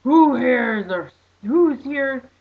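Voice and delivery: slow, loud preacher call; mock preacher asking who has sinned; preacher's call. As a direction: boom it out like a street preacher. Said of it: "the guy says".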